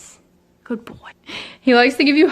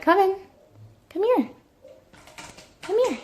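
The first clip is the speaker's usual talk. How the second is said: high sing-song come here; calling the cat; 10 semitones higher, 4 dB quieter than usual. Real, not acted. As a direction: coax the cat over, high.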